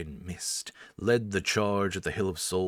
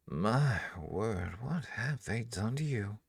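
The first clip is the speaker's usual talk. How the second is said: rival wizard's lazy, superior drawl, higher on the question; familiar drawl; 5 semitones higher, slower than usual. Acted. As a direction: drawl it out as if mildly amused by the ruin in front of her. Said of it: "in an infuriatingly familiar drawl".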